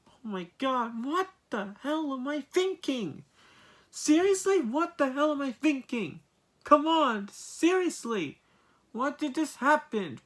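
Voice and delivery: high-pitched, incredulous frustration; exasperated outburst. Real, not acted. Reviewer at a loss over the film, exasperated. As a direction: cry out in high, frustrated disbelief.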